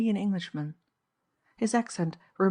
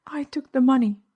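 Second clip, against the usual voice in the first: higher, louder admission; confessing; 7 semitones higher, 8 dB louder than usual. A narrator's reading. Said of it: "she confessed".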